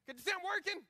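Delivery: high, loud irritated snap; irritated yell. Retold or imitated. A busy father yelling at his wrestling boys to get off. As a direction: shoo the kids off, high and annoyed. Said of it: "and I'm like".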